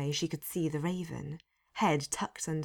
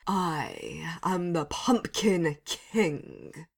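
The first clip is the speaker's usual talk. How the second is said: slow, louder declaration; grand proclamation; 4 dB louder, much slower than usual. A narrator's reading. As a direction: puff up and announce yourself. Posed dramatically.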